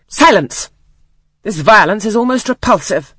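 stern command. A narrator's reading. Aunt cuts off the child.

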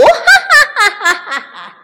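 high, loud mocking laugh; derisive cackle. A narrator's reading. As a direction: weasel villain's jeering "ha ha". Weasels laugh at the offer.

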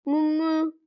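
long, level moo; cow mooing. Pretend. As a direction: imitate a cow, holding the moo. Child-lesson cow introduces itself.

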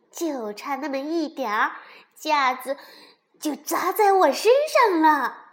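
loud, rising angry complaint. A narrator's reading. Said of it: "蹦蹦生气地说". (生气地越说越大声)

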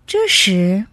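ordinary delivery

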